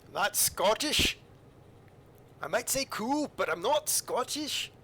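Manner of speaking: playful bad Scottish voice; mock Scottish accent. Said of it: "Three out of ten on the Scottish accent".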